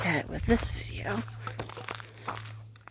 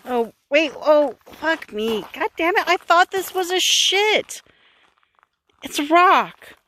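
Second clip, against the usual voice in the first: higher and louder, mock outrage; loud exasperation; 8 semitones higher, 9 dB louder than usual. Real, not acted. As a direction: blurt it out, disgusted then deflated. Disgusted at mistaken find.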